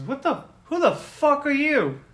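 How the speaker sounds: high, loud indignant demand; acted outraged stranger; hostile challenge. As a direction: confront the intruders, voice jumping up. Hostile stranger confronts intruders.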